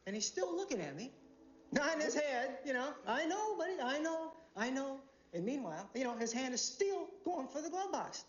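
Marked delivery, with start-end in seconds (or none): 3.1-5.0 placating. Acted. Driver nodding along.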